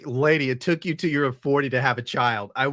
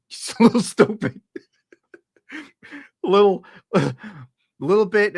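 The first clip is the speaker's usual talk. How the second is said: words breaking into real laughter; laughing; 7 semitones higher, 6 dB louder than usual. Real, not acted. Laughing through his own joke.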